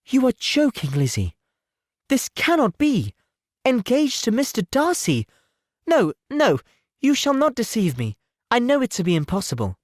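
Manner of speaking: sweet sister refusing to believe the news; incredulous Jane. Acted. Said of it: "absolutely incredulous".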